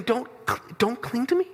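disbelieving repeat, rising at the end; incredulous echo. Retold or imitated. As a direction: echo the words as if outraged. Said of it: "and you're saying".

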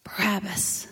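unvoiced whispered name; hushed crowd whisper. A narrator's reading. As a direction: breathe the name like a rumor. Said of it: "I hear whispers".

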